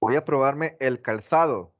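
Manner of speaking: "calzado" with z as a th sound; tongue between teeth for the z in "calzado"; Madrid ceceo. Shown as a demonstration. Teacher imitating Spaniards for the class, Castilian th. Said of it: "¿Cómo lo dicen ellos?".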